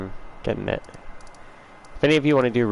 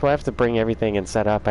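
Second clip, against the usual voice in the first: ordinary talk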